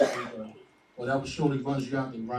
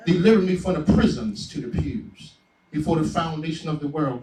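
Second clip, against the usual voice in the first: poem recital; 3 semitones higher, 7 dB louder than usual. Acted. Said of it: "Just wanna give you this quick poem".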